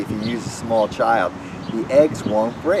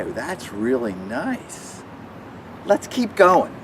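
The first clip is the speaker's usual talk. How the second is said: put-on children's magic show patter; 4 semitones higher than usual.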